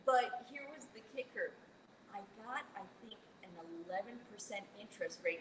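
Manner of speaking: ordinary talk, nothing marked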